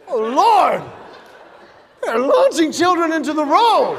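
a lightly voiced horrified tourist; alarmed foreign driver. Foreign driver gasping at the seesaw sign in mock alarm. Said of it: "Imagine those poor foreign drivers going".

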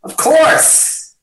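high, breathy gushing "Of course"; "Of course" squeaked high and airy; mock gushing. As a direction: gush the word "course" up high like a fan. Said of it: "I'm like".

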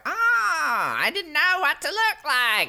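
high, whiny comic screech of an impersonation; Fran Drescher. Acted. She meets the angry leader, high hammed-up impersonation. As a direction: do an exaggerated, very high-pitched actress voice. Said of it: "Dalton and Fran Drescher impersonations".

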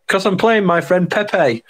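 imitating a giddy footballer; Alioski impression. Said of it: "You can imagine him just like that".

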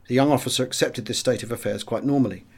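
plain reading voice

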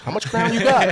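high, loud eager question; punchline quote, pitched well up; imitated sudden eagerness. Marty switching off the truck, imitated eager interest.

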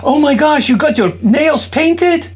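sweet voice for daughter. Real, not acted. Dad gushing to his little daughter about her nails, high sing-song voice. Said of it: "Why are you talking like that?".

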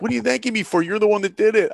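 excited friend. Retold or imitated. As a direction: hype up your buddy who just made it. Friend reports the acceptance.

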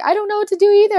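high, loud, helpless mom voice; imitating emotional mom. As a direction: play the panicked, emotional mother. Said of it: "my mom is more of the emotional one".

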